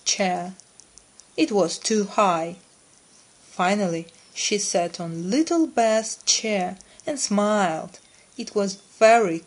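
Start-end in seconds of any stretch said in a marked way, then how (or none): none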